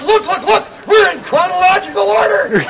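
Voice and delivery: hammed-up fans yelling over each other; mock yell. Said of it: "They kept yelling out".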